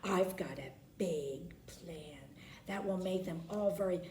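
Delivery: sly whispered scheming; hissed scheme. Acted. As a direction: hiss the plan under your breath. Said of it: "hissed blabbering Beth Ann".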